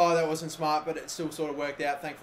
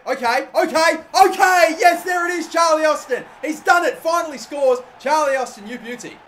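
much higher and louder, ecstatic yelling; goal celebration shout; 9 semitones higher, 10 dB louder than usual. Real, not acted. Player celebrating Charlie Austin's goal, ecstatic shouting. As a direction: scream it like your striker just scored.

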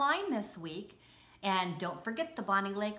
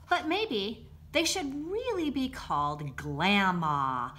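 lively picture-book read-aloud; bright, bouncy storybook narration; reading to children. Acted.

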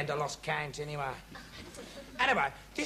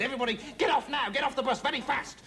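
higher, hurried command; urgent order; 7 semitones higher than usual. Acted. Driver ordering everyone off the bus, urgent. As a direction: rush the passengers off the bus.

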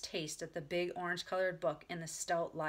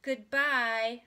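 loud, higher, pointed farewell; grown-up little girl; 4 semitones higher, 8 dB louder than usual. A narrator's reading. Ramona refuses baby talk, firm loud goodbye. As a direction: say it firmly like a child acting grown. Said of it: "too grown up".

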